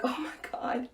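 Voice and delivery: whispered, awestruck aside; hushed awe. Retold or imitated. Keeping a straight face in the circle, whispered awe. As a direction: whisper it as if hiding the reaction.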